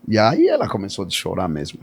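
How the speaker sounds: ordinary delivery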